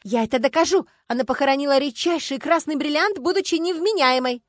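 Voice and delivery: high, loud, insistent accusation; outraged protest. A narrator's reading. Starkova, restrained by husband and lawyer, accusing Anfisa furiously. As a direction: protest at full pitch.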